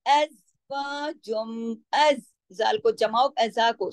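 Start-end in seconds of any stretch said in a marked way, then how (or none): 0.0-1.8 slow अज़वाजुम, humming on the final m; long ghunna. Shown as a demonstration. Teacher models the merger, अज़वाजुम with nasal hum. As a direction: recite slowly and hold the m in the nose. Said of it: "बड़ा गुन्ना होगा".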